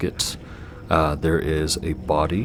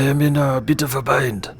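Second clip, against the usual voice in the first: put-on whispered French accent; 8 semitones higher, 5 dB louder than usual. Dragon voice, whispered.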